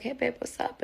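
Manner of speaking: high, casual phone greeting; lightly voiced boyfriend. Man answers his partner's call, lightly acted casual greeting. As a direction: imitate a carefree partner picking up.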